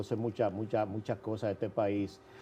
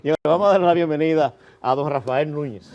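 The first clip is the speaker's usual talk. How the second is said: announcer welcome; 5 semitones higher, 12 dB louder than usual. Real, not acted. Host welcomes guest on air.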